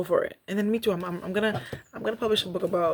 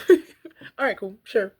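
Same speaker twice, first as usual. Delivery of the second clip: mock-breezy; 5 semitones higher, 9 dB louder, much slower than usual. Mock-casual shrug at rejection.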